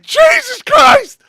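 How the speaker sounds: loud high shout of shock, drawn out; stretched-out loud gasp of a curse; shocked yell. Retold or imitated. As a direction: yell it like you can't believe your eyes. Sees neighbor through window.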